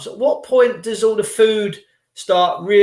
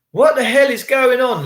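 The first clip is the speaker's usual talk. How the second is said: ordinary delivery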